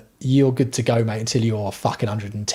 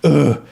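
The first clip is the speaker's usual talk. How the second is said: louder morning groan; 2 semitones higher, 5 dB louder than usual. Retold or imitated. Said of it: "sit down and go".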